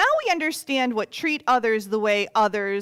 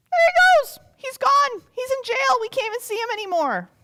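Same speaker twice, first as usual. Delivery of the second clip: much higher and louder, rushing at the end; excited onlooker; 8 dB louder than usual. Acted. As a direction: gasp it out like a child watching. Onlookers watch servant taken.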